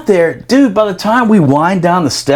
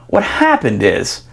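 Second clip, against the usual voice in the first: normal talking voice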